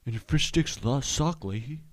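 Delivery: high put-on comic character voice; TV character impression. Fan mimicking Trailer Park Boys, high put-on voice. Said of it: "That was spot on".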